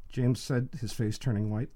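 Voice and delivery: plain reading voice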